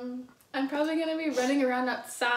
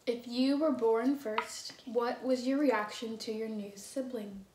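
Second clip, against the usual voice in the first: ordinary delivery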